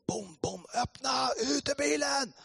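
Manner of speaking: high, drawn-out shouted order; police shout. Retold or imitated. Police ordering him out of the car, shouting.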